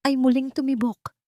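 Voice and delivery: ordinary delivery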